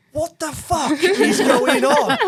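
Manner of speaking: high slow laughing outburst. Retold or imitated. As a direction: blurt it through laughter. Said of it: "she'll come down and be like".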